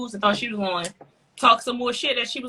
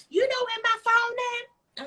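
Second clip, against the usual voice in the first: high, snotty mimic of the lady; high mocking impression of a rude passenger; 12 semitones higher than usual.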